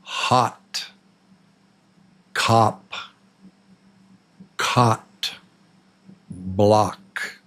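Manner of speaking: "Hot", "Cop", "Cot", "Block" with an open "ah"; short O drill. Shown as a demonstration. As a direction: open the jaw on each word. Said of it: "And then the ah sound".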